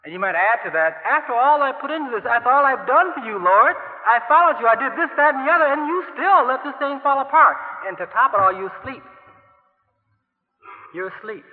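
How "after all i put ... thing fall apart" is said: higher, louder grievance poured out at God; loud, higher reproach of an aggrieved believer; aggrieved complaint. Pretend. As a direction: list every sacrifice in rising indignation. Voiced aggrieved prayer to God.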